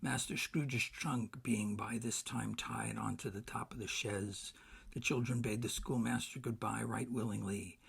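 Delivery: usual speaking voice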